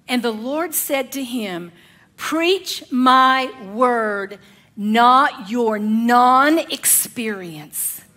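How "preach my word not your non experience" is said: very slow, weighty voice of God; slow divine command. Acted. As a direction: lay each word down like a verdict. God's voice, very slow.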